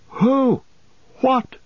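high, alarmed call; shouted question. A narrator's reading. Frodo rushing round the tree to Merry, shouting in alarm. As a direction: call out sharply in high pitch without full volume. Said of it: "shouted Frodo".

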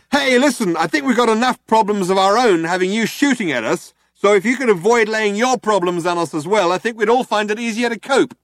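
loud and high, reasoning across the room; shouting out. A narrator's reading. Ford shouting to the cops, loud and reasoning. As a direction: shout your case to the cops. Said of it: "Ford shouted out".

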